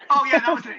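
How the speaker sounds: yes, that was it, loud and high; sudden recall. Real, not acted. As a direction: it all comes back at once. Sudden recollection of the story.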